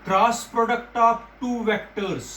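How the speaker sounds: very slow, word by word; slow dictation. Shown as a demonstration. Teacher making students memorise the term, very slowly. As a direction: spell it out slowly for the class. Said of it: "ये याद रखना".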